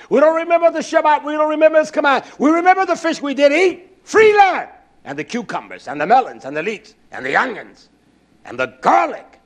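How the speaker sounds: high, loud preaching cry; full-force preaching. Acted. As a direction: preach it hard to the congregation.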